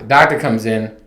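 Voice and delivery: ordinary talk, nothing marked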